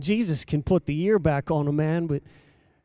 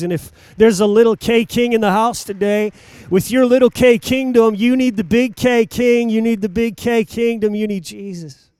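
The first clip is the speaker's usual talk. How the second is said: louder, driving exhortation to the congregation; preaching at full force; 4 semitones higher, 7 dB louder than usual. Acted. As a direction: push it at the crowd like a revival preacher.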